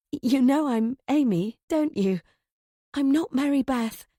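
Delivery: pleading to be seen as herself; anxious plea. A narrator's reading. Narrator as Amy pleads with confused landlady, anxious.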